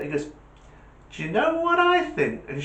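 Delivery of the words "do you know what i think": put-on a boy bursting to share his idea; high kid voice, eager; much higher eager child. Jimmy pesters his mum again, high eager kid voice.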